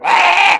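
put-on shrill, high toddler shriek; toddler scream. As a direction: imitate a little kid screeching. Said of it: "they'll get into it and be like".

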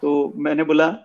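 normal talking voice

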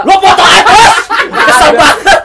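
hammed-up irritated cop snapping back; annoyed policeman. Policeman in the reenactment loudly fumes at the man.

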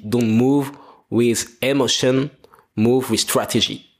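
English in a heavy French accent, slow; slow French-accented English, teasing himself. Shown as a demonstration. Podcaster quoting an English phrase to listeners, French accent. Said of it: "t'as vu mon accent français".

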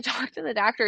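ordinary talk, nothing marked

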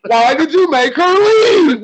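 high, slow, drawn-out plea; imitating friend's plea. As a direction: mimic the friend's plaintive question, stretching leave. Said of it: "asked him".

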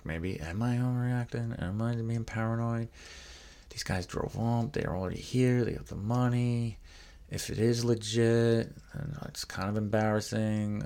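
acted-out wavering; self-doubting musing. Acts out his own second thoughts.

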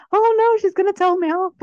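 high, loud comic dread; mock panic. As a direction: wail in playful alarm, laughter close behind. Retold panic at being scolded.

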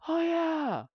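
sudden high, loud "oh yeah"; imitating a thrilled fan; mock excitement. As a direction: burst out like it's great news. Mock future self excited.

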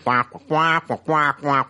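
muffled trombone-like wah wah of the Peanuts teacher; mock the droning teacher from Peanuts. Mocking the rating-system intro as Peanuts teacher wah wah. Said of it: "it's like the fucking Peanuts teacher".

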